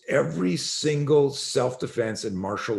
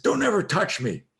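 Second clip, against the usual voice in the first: retold scream; 11 semitones higher than usual. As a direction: imitate a student screaming a warning, held back. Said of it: "and I screamed".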